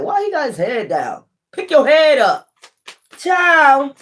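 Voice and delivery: put-on rising high and pleading, up to a cry on child; high teasing call to a stranger; calling out in mock concern. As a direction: coax him like a worried auntie. Urging man to lift head.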